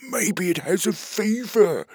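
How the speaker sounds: stubborn little creature voice; high husband voice. Acted. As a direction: insist back in a high squeak. Husband character voice.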